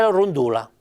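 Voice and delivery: rondola con l'accento sulla penultima; pronuncia sbagliata. Shown as a demonstration. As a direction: leggi la rondola come parola piana. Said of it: "se in piemontese mi ... la scrivo parei". (pronuncia errata di rondola)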